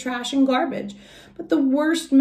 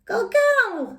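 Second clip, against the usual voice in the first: cheering cub; 13 semitones higher than usual. Acted. Little cub cheering Mama.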